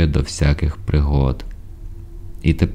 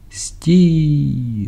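very high, long falling call; drawn-out cry; 11 semitones higher than usual. A narrator's reading. Voice ordering the fleeing ship to stop, long high cry. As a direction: hold the vowel long, calling high across water. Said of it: "закричав голос".

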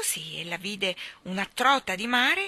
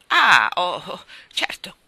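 loud "ah", then subsiding; dawning realisation; 12 dB louder, slower than usual. A narrator's reading. Hake hears the trout's murmured explanation, realising. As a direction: burst out, then settle into agreement.

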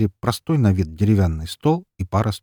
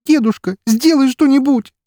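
очень высоко и громко; кричи деду в слезах; отчаянная мольба криком; 9 dB louder than usual. Acted. Елена умоляет деда. (Елена кричит, умоляя деда)

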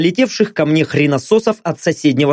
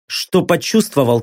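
plain reading voice

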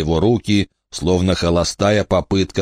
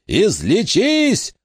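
high, very slow scream; drawn-out scream, much higher; 14 semitones higher, much slower than usual. A narrator's reading. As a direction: scream it through the pain. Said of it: "закричал Уэйн".

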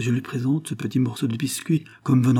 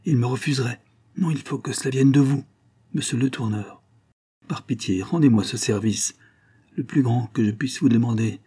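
ordinary delivery

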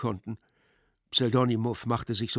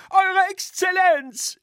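very high, loud, stretched-out cry; screamed heckle; 9 dB louder, slower than usual. A narrator's reading. Drunk schoolboy interrupts His Excellency, screaming. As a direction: scream the title slowly and high. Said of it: "schrie die Stimme abermals".

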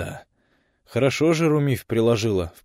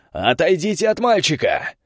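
high loud order; commanding shout; 9 semitones higher, 6 dB louder than usual. Acted. Officer commands during fight.

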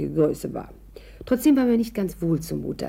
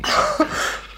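delighted breathy laugh. Acted. Delighted at fur coat offer.

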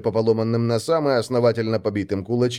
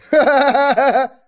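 high, braying horse laugh; mocking thuggish cackle; loud guffaw; 13 semitones higher, 6 dB louder than usual. A narrator's reading. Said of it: "заржали оба парня".

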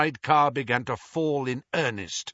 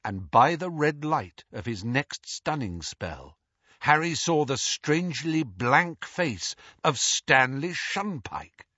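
plain reading voice

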